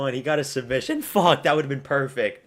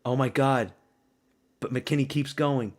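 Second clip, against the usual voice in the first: normal talking voice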